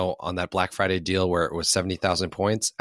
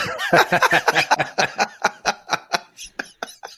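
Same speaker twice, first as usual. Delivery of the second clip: loud laugh; 13 semitones higher, 5 dB louder than usual. Real, not acted. Cackling at the joke.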